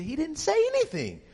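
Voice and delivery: high-pitched imitation of mom; mother's denial. Mother to son in church, imitated high protest. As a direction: squeal it as a disbelieving mother.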